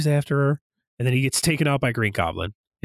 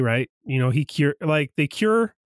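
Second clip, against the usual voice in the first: normal talking voice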